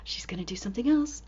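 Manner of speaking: fast hushed whisper; hint at what's coming. Acted. Reader to children, quick whisper.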